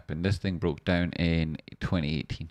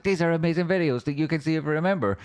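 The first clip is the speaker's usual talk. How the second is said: mock ad voice; 9 semitones higher, 3 dB louder than usual.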